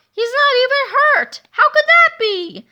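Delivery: very high, loud outraged disbelief; shocked disbelief. Acted. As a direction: shriek in baffled fury. Villain baffled by survivor.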